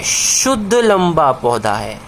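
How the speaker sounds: slowed, each word set apart; slow emphatic answer. Shown as a demonstration. Teacher to students, drawing out the new term slowly. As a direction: spell out the answer slowly for the class. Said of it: "लेकिन कैसा लंबा है?".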